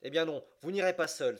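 loud, high cry, moved despite himself; moved outcry. A narrator's reading. Captain crying out to Fogg, moved and loud. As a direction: burst out, unable to hold back. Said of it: "s'écria le capitaine, ému malgré lui".

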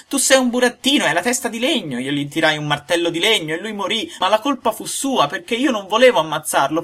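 put-on high, bright-eyed wooden boy pouring out his story; a little puppet excusing himself; high puppet voice, loud and eager. A narrator's reading. Pinocchio's voice telling his night.

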